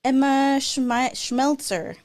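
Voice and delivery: usual speaking voice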